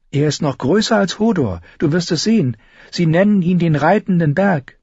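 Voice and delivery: put-on an eager older sister with a touch of awe; Sansa. Sansa telling Arya about Mountain.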